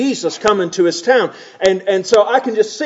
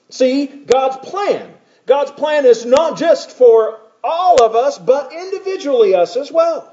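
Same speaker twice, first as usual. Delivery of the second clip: preaching emphasis; 5 semitones higher than usual. Real, not acted. Preacher driving the point.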